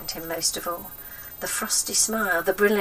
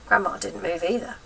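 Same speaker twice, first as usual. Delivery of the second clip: plain reading voice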